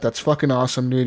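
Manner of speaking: usual speaking voice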